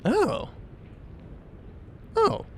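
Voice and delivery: high-pitched, halting startled reply; hesitate, caught off guard, voice high. Acted. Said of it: "Why do you seem so startled?".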